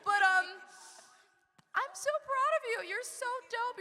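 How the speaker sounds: high, strained tearful voice; holding back tears. Real, not acted. Host praising guest on stage, holding back tears. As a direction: try not to cry in front of people. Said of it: "I don't wanna cry in front of people".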